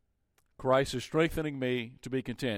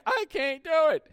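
put-on child whining; 13 semitones higher than usual. Child protesting to parent that they can't, whiny. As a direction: whine like a stubborn little kid.

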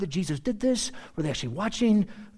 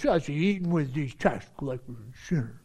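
mocking Pharisee; 7 semitones lower, 5 dB quieter than usual. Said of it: "as far as the Pharisees were concerned".

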